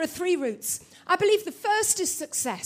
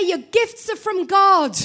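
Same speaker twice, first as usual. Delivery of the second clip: slow, emphatic preacher line; preached slowly; 6 dB louder, slower than usual. Shown as a demonstration. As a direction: model it for the congregation to repeat. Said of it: "Turn to your neighbour and say".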